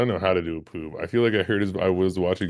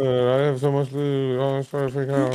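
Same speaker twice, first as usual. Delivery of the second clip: Peanut impression; 6 semitones higher than usual. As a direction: copy a teammate's voice, slowed down. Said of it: "Well, I'm Peanut".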